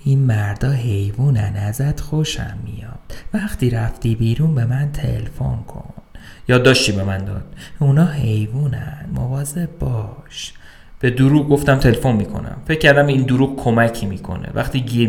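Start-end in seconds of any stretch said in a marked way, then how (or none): 0.0-5.9 soft, slow confiding words; quiet voice. Acted. Plainclothes cop quietly confides in the prisoner, soft and slow. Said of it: "با صدای آرومی گفت". 7.8-10.5 soft, slow hushed caution; quiet warning. Acted. The note warns the prisoner, soft and slow.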